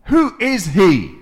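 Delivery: loud, high, slow challenge; David shouting his defiance at the giant; defiant shout. Acted. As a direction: call it out across the valley.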